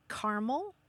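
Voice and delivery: caramel as CAR-mul, two syllables. Shown as a demonstration. Host listing US variants for caller, caramel as CAR-mul. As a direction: say caramel with AH and the middle vowel dropped. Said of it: "some people say".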